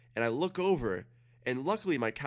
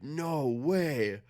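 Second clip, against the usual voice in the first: drawn-out, higher-pitched mock disbelief; disbelieving; 4 semitones higher, 3 dB louder than usual. Friend hears about the babysitter, disbelieving. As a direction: imitate a stunned friend. Friend's amazed reaction, retold.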